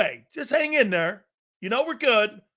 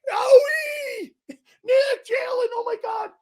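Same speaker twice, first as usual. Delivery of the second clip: put-on high, shrill teen concert shriek; screaming fangirl. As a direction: scream the names like a starstruck fan. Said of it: "like he's at a [name] concert".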